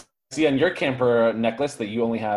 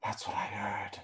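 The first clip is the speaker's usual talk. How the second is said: breathy hushed whisper; 3 semitones lower, 14 dB quieter than usual. Acted. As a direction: whisper it, scared. Whispered reply.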